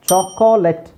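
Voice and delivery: ಚಾಕೊಲೇಟ್ in three syllables, cho-KO-let; wrong pronunciation. Shown as a demonstration. Teacher showing what we don't say, wrong pronunciation. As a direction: sound the middle o in ಚಾಕೊಲೇಟ್. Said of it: "ಅಂತ ಹೇಳಲ್ಲ".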